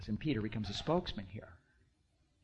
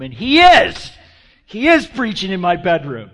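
mock-outraged shout, much louder and higher; outraged yell; 5 semitones higher, 18 dB louder than usual. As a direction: imitate an appalled man yelling his complaint. Listener's outraged complaint, acted out.

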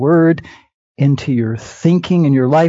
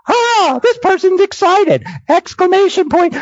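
much higher, bright demo of an excited cry; mock excited exclamation. Shown as a demonstration. Preacher shows his flock what an exclamation sounds like, excited. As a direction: play up the exclamation point as an example. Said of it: "We kind of put in mood by an exclamation point".

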